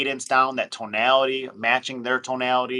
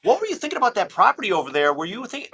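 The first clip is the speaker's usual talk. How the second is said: tone-deaf salesman; 10 semitones higher, 6 dB louder than usual. Retold or imitated. Caller ignoring a seller's divorce, high, loud fake sympathy. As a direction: brush past his pain into the pitch. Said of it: "you're like".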